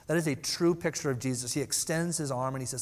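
normal talking voice

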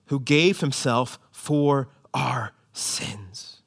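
slow hushed whisper of "Who gave himself for our sins"; whispered verse. Shown as a demonstration. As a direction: breathe it out, slow and secret. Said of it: "Not really".

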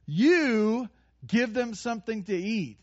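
"You" given extra weight at the start; stress on You. Shown as a demonstration. Jesus telling disciples to feed them, stress on "You". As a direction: punch the word "You". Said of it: "is emphatic there".

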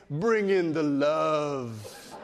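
slow, drawn-out DJ croon on the last word; deep radio DJ. Retold or imitated. Preacher imitates a four-year-old wanting a hug, deep radio DJ voice. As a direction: put on a big radio DJ voice, stretching it out. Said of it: "In a deep voice, like he's a radio D J".

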